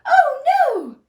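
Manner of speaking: high, loud squeak of alarm; squeaking mouse alarm. Acted. Mouse hears the roar, high alarmed squeak. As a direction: cry out in fright. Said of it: "squeaked the mouse".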